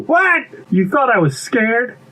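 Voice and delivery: high, startled "what", then scoffing; incredulous denial. Acted. Bee denies being scared to pea, incredulous. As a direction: pitch up in mock disbelief.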